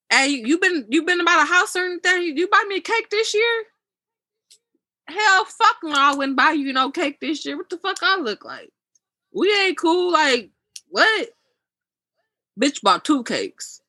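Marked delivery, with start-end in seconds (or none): 0.1-3.6 higher, half-playful voice of the friend asking, rising at the end; caller's question. Retold or imitated. Caller asks about birthday cake. 5.1-11.3 higher, sassy scoff, acting offended; mock indignant refusal. Her retold snappy refusal.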